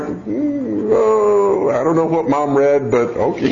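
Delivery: doubtful, drawn-out mm and oh; skeptical kid. Acted. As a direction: humor mom without believing her. Doubting child reacts to mother's promise.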